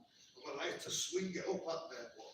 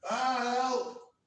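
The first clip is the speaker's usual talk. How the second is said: sudden high, much louder cry of help; yell for help; 13 semitones higher, 14 dB louder, much slower than usual. Retold or imitated. Faking pain under a beating so the gang stops, high loud yell. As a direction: cry out like you're in pain so they stop. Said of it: "Next minute I start going".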